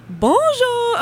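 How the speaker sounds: mock French bonjour, high and loud; exaggerated French impression. Host asks for retry, she hams a French greeting. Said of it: "let me try not to do an impression of it".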